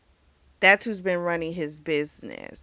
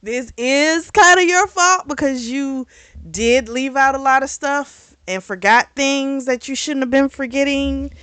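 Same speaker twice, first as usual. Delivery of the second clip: higher, louder scolding of the character; 5 semitones higher, 5 dB louder than usual. Real, not acted. Viewer telling the show's hero it's his fault, raised scolding. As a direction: tell him off, pitched up and louder.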